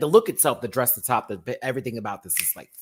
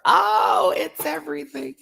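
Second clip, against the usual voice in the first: much higher, shouted squeal of delight; ecstatic cry; 14 semitones higher, 7 dB louder than usual. Real, not acted. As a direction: scream it like your favorite just walked out. Overwhelmed by a favorite look.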